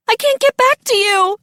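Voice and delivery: high-pitched panicked call; desperate cry. A narrator's reading. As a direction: cry out, cut off from dad. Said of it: "Akira cried".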